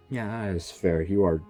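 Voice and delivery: ordinary delivery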